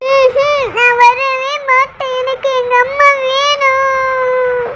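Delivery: put-on very high, slow crying-kid whine; stubborn child tantrum. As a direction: stretch each word into a stubborn wail. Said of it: "என்ன இவ்ளோ அடம் பிடிக்கிறான்". (அடம் பிடிக்கும் அழுகைக் குரல்)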